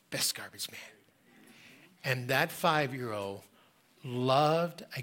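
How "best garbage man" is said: hushed whispered echo. Real, not acted. Preacher recalling the boy's words, whispered. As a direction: breathe it softly, tender recall. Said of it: "whisper heard".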